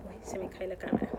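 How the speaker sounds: a quick startled whisper; hushed aside about the slipping camera. Real, not acted. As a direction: drop to a whisper as the camera falls. Camera slips mid-story.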